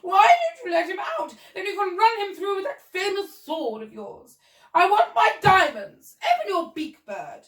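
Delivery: high, loud indignant lady; demanding cry. Acted. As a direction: demand it imperiously. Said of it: "cried the Duchess".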